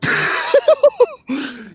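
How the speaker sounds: big laugh. Real, not acted. Friends tease over smiling.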